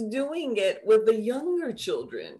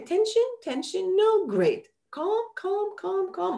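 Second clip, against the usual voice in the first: soothing guidance; 11 semitones higher than usual. Shown as a demonstration. Teacher guiding child's body scan.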